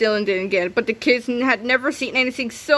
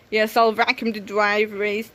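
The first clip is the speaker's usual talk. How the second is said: plain reading voice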